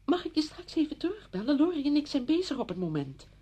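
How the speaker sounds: high, loud mimicry of her brush-off; quoting the friend. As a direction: throw her words back at her. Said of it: "en jij zei".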